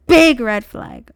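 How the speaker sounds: big hit loud in big red flag; stress on big. Real, not acted. Repeating the red flag warning, big punched loud. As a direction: punch big hard and slow.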